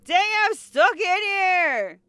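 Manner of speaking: high, loud, drawn-out complaint; hammed-up panic yell; mock distress. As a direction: holler that you are trapped. Player trapped in game room.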